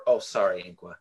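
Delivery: normal talking voice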